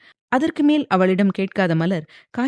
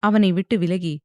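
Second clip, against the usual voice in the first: usual speaking voice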